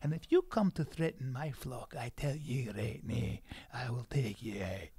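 solemn Highland warrior warning; Scottish accent. Acted. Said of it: "Your Scottish voice".

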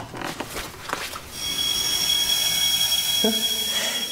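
long high breathy giggle; teasing laugh. Acted. Young woman giggling at the wounded man before teasing him. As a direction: giggle at him, pleased and mischievous. Teasing after agreeing to stay.

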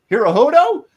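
playful wrong name; mock mispronouncing agent. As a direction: ask it with fake innocence. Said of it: "and he'd go".

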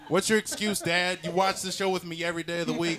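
put-on high, indignant kid voice; child demanding an explanation, pitched well up; five-year-old self. Kid confronting dad over the show they watch together, high and indignant. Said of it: "and five years old".

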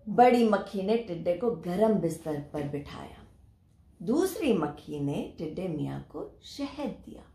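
ordinary delivery

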